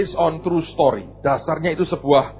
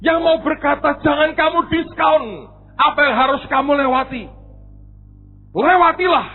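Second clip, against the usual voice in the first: high, forceful sermon delivery; impassioned preaching; 11 semitones higher, 4 dB louder than usual. Real, not acted. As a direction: drive the lesson home to the congregation. Preaches the lesson at full force. (khotbah berapi-api bernada tinggi)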